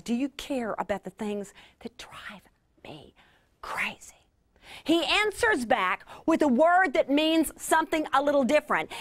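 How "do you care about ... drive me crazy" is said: hushed, voiceless frantic whisper; whispered exasperation. Retold or imitated. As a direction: breathe it out like a secret complaint. Voicing a frazzled personality.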